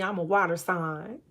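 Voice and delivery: normal talking voice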